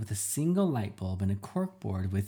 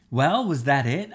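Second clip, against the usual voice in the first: louder, higher insistent demand; forceful demand, no more dodging; 6 semitones higher, 7 dB louder than usual. Acted. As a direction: press her hard for an answer. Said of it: "I demanded".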